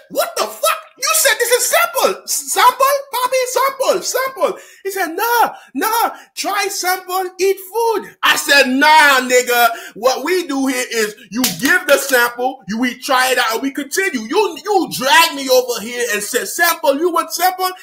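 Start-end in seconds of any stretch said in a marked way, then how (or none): 0.1-4.6 high-pitched, shocked protest; high indignant squawk; outraged disbelief. Retold or imitated. Outraged at the bill. 5.1-8.1 high, eager host voice; lightly voiced generous host; imitating the restaurant guy. 8.7-17.7 indignant shouting; angry rant. Retold or imitated. Arguing with the guy.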